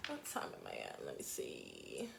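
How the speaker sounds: whispered aside to herself. Real, not acted. Teacher checking the time, whispering to herself. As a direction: breathe it out, unvoiced and low.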